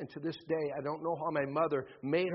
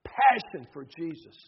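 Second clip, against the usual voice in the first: ordinary talk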